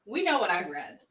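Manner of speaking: ordinary delivery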